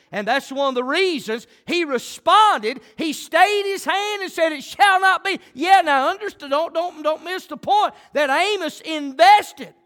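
loud, high, ringing preacher's cry; full-throated revival preaching; preaching at full force. Acted. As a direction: drive it home to the congregation.

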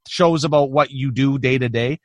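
ordinary delivery

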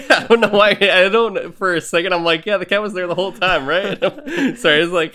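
amused outburst, words riding on laughs; laughing. Real, not acted. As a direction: talk through real helpless laughter. Cracks up over missing the cat.

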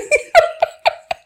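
high, airy giggles; burst of laughter. Real, not acted. Host after joking about taking cash, high laugh. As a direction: laugh on after your punchline.

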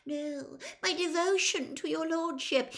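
high, squeaky protest; pleading servant. Acted. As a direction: plead devotion to an angry master. Servant protests, gets cut off.

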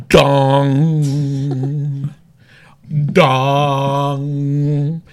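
slow tolling "dong" like a gong; bad bell impression. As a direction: toll it out like a funeral bell. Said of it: "That's a bad impression".

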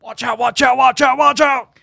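wrestling announcer yell; hype shout. Pretend. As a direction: scream it like a ring announcer.

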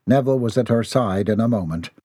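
ordinary delivery, nothing marked

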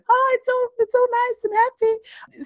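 high, sing-song fake brightness; mock cheerful. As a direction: chirp it like everything's fine. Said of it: "I just naturally just want to go".

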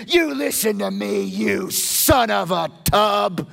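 slow, loud, pointed scolding of a king; Saul's angry rebuke. Retold or imitated. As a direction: lean in on it like a furious king. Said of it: "Saul basically said".

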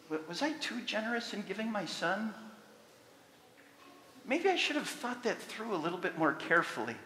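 soft, hushed musing voice of God; a hint of God having second thoughts; mock God doubting. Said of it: "scripture passage that says from God's perspective".

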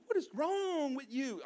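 exasperated mock question. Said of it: "I'm like".

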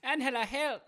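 high thick peanut-butter voice; mouth full of peanut butter. Pretend. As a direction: call out with a stuck mouth. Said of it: "peanut butter stuck to the roof of your mouth".

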